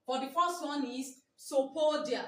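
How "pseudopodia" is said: Sopodia with the opening P silent; correct pronunciation. Shown as a demonstration. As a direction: say Sopodia starting straight on the S. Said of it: "The, the correct pronunciation".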